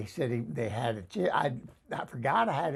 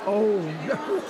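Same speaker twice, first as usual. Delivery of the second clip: higher, louder, drawn into laughter; amused dismay; 5 semitones higher, 5 dB louder than usual. Real, not acted. Laughing reaction to a mishap.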